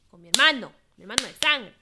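loud insistence; emphatic loud. Real, not acted. Insisting it means a real brother, loudly. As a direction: punch it out loud.

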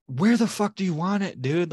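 high, exasperated demand; retold flipping out. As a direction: re-enact losing your cool at a customer. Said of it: "flipped out".

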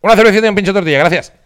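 high, loud snappy order; quick bar order. Retold or imitated. Ordering at the crowded bar, loud quick order. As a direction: get the order in loud and fast.

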